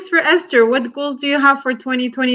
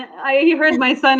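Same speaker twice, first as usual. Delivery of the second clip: ordinary delivery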